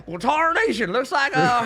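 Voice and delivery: hammy Wild West prospector; old prospector. Acted. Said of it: "even turn on an accent".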